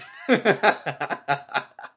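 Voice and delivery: bursting out laughing; a real, open laugh. Real, not acted. Laughs at own joke.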